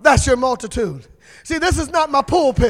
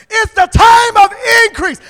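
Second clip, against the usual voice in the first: higher and louder, increase ringing out; shouted proclamation; 7 semitones higher, 5 dB louder than usual. Shown as a demonstration. Preacher restating it louder for the congregation. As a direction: proclaim it to the rafters. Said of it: "Let me just say it like that".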